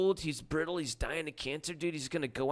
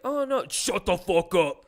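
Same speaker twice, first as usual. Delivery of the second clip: loud, high retold outburst; hammed-up aggressive shutdown; mock angry yell; 8 semitones higher, 10 dB louder than usual. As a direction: imitate a drunk bully shouting someone down. Aggressive man retold in story.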